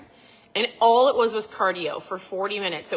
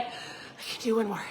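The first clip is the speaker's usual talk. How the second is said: self-encouragement; 8 dB quieter than usual. Retold or imitated. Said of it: "And I'd be like".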